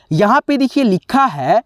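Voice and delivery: normal talking voice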